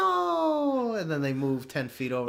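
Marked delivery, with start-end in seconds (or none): 0.0-0.9 very high, loud cartoon "Oh, no!"; cartoon cry. Podcaster hamming up a Dora cartoon gasp, very high and loud. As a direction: squeal it like a kids' show character. Mock cartoon character exclamation.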